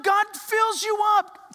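slow exultant cry; preaching fervor. Acted. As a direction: lift the church with it. Preaching climax.

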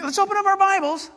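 high, bright mock teacher call; loud high call to the class. Teacher calling the kids to open Bibles, high and loud. Teacher addresses five-year-olds.

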